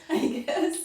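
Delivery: laughing through the words; a real laugh on the tail end; amused giggly aside. Real, not acted. Laughing after church-as-therapy joke.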